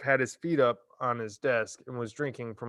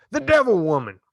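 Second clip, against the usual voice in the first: loud, higher denouncing cry; brand her for the whole mob; shouting; 9 semitones higher, 8 dB louder than usual. Acted. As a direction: Trout yelling at the teacher, high and loud. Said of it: "Trout shouted".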